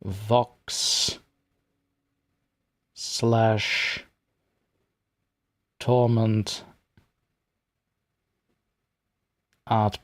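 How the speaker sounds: word by word with long gaps; typing aloud. Real, not acted. Streamer messaging the artist about her art, spoken haltingly while typing. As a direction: say each word slowly as you type it.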